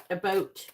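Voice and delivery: "a boat" as Canadian "about", said loud; "boat" with a long O, for Canadian "about". Shown as a demonstration. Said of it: "It sounds like boat".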